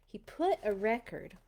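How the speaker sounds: usual speaking voice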